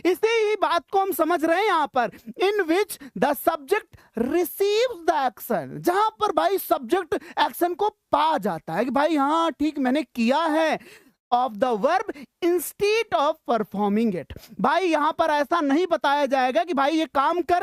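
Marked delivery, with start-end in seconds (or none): 2.4-5.8 slow reading. Shown as a demonstration. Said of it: "teacher reads definition slowly". 11.3-14.5 slow reading. Shown as a demonstration. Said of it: "teacher reads definition slowly".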